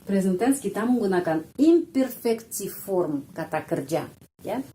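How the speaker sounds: normal talking voice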